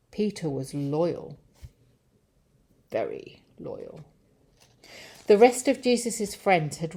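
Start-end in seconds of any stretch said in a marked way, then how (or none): 2.9-4.0 very low, hushed echo; deep, soft storyteller's aside; deep, solemn. A narrator's reading. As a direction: drop right down low and quieter. Echoes loyal, drops very low.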